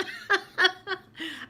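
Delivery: bright high laugh. Real, not acted. Laughing at own story.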